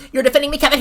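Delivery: high sneering mock voice; a hint of a sarcastic viewer; mocking critic. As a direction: whine it like a smug commenter. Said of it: "people are gonna take this as".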